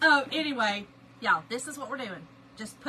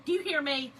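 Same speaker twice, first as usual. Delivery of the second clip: ordinary talk, nothing marked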